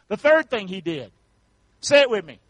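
ordinary talk, nothing marked

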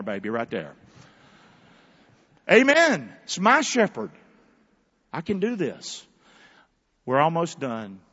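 usual speaking voice

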